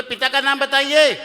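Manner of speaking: high, loud repeated question; insistent demand. Acted. Priest asking for the father's name, loudly insistent. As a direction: call it out insistently.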